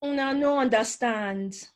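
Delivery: put-on in Nigerian Pidgin, teasing the kids; lightly voiced Pidgin jab at the kids. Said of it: "You, you tell them".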